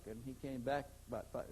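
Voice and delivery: ordinary delivery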